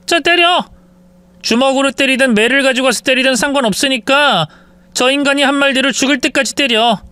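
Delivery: mocking, high, icy commands; cruel wife. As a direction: savor his own words against him. Wife orders mother to hit son.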